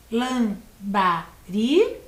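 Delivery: Lambari split as LAM-BA-RI, slow with rising end; syllable by syllable. Shown as a demonstration. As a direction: count out Lambari with pauses between syllables. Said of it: "Três sílabas". (Lambari separado em três sílabas, bem devagar)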